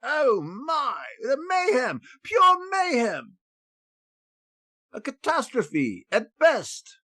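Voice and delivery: hammed-up English accent, high and rising to a shrill shout; a panicked Englishman discovering his horses gone, in English accent. Groomsman back from tea finds horses gone, in English accent. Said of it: "That's my best English accent".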